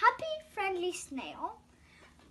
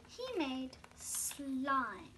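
ordinary delivery

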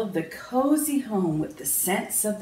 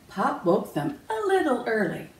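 plain reading voice

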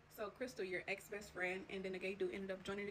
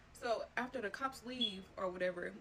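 normal talking voice

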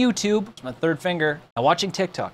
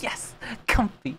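softly contented; soft cozy murmur; 3 semitones higher, 8 dB quieter than usual. Retold or imitated. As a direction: murmur it snuggled in. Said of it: "you're just like".

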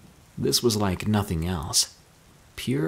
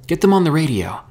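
higher, louder command; urgent order; 7 semitones higher, 6 dB louder, faster than usual. A narrator's reading. Herschel orders Mika to radio their friends, urgently. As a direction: press for action at once.

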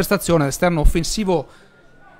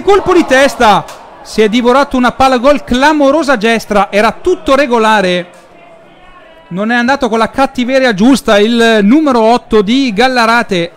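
breathless rising shout over a missed chance; excited goal commentary; 4 semitones higher, 5 dB louder than usual. Real, not acted. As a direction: call it loud and high, close to a goal. Near miss header in live commentary.

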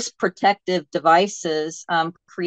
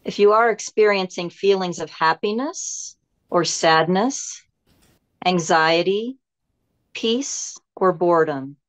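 guided meditation. Acted. Teacher leading listeners to let go and go inside, guided meditation. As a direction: guide them inward, phrase by unhurried phrase.

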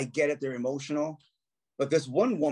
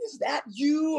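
surprised question; 12 semitones higher, slower than usual. Retold or imitated. Fan recognizes him in lobby.

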